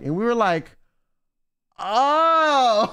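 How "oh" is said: long, high drawn-out "oh"; mock revelation, stretched out and pitched up; exaggerated group "ohhh" as it all clicks; retold realization. Retold group realization about the dog.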